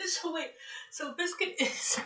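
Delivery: high, loud laughing through the words. Real, not acted. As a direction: giggle while asking. Laughing at confusing word.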